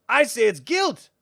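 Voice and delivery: high, loud, pointed sprite voice; accusing brownie. Acted. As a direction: needle him with it. Frangine accuses Willow.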